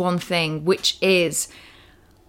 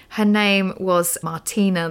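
normal talking voice

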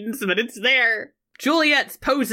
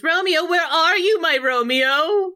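Juliet; 8 semitones higher than usual. Retold or imitated. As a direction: call out for a lover in a raised, theatrical voice. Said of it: "'cause Juliet goes".

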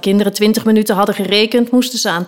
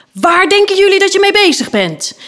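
high-pitched, raised demand; indignant teacher's yell; 11 semitones higher, 4 dB louder than usual. Acted. Knight bursting in on an unruly class, indignant yell. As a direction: storm in and scold the whole class.

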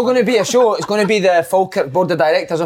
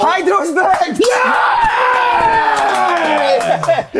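high cheering shout, fist in the air; excited shout; 13 semitones higher, much slower than usual. Real, not acted. Cheering to the lads that the Hydro's back, high excited shout. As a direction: shout it out like big news.

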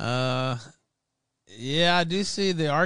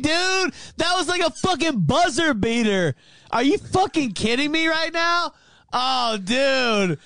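ordinary talk

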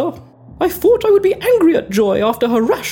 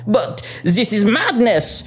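put-on French accent; 6 semitones lower than usual. Frenchman protests.

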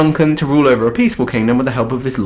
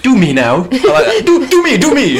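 high-pitched, giggly begging; mock eagerness; 12 semitones higher, 3 dB louder than usual. Co-host playing Banquo begging witches, high giggly eagerness. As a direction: plead excitedly like an eager himbo. Clamouring jokingly for a prophecy.

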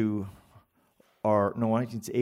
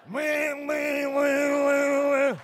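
high, rising mock buzzing shout; clipper buzz; much slower than usual. Barber buzzing off the lovely hair, high mock clipper noise. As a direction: imitate the clippers going wild.

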